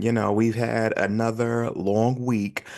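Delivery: ordinary delivery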